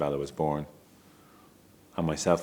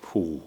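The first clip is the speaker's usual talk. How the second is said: a soft whispered whew, letting the breath go; stunned exhale; 3 dB quieter than usual. Retold or imitated. Recalling his stunned moment at the RDS, a breathy whew. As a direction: breathe it out like you can't believe it.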